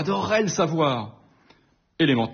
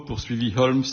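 plain reading voice

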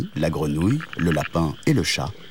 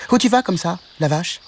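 little frog voice; 10 semitones higher than usual. A narrator's reading. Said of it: "lança l'amphibien".

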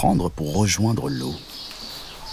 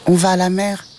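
high-pitched cat voice; small kitten; 11 semitones higher than usual. A narrator's reading. The cat explains their blocked road to the mantis, high-pitched. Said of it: "répondit le chat".

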